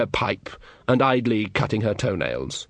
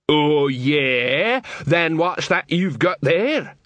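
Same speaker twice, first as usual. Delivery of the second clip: challenging troll; 4 semitones higher, much slower than usual. Acted. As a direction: call his bluff.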